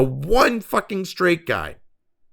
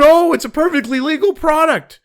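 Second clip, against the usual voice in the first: sneering high mock voice, up in pitch and louder; mocking sellers; 11 semitones higher, 5 dB louder than usual. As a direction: parrot their excuse in a snide, high voice.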